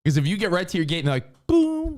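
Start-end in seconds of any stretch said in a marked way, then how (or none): 1.5-2.0 high-pitched held "boom"; high, drawn-out interjection; imitated announcement cue. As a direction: sing out a high sound effect. Imitates sudden gate boarding announcement.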